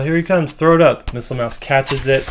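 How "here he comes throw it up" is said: louder, higher acted shout; calling out. Comic character warns that he's coming, calling out. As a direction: call it up to a teammate, retold.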